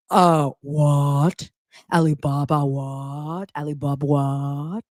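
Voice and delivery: slow, stretched, rising mock-shocked questions; a put-on voice of a gasping socialite; Meredith impression. Recapping Meredith hearing the gossip, a mock shocked drawl. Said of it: "Meredith is like".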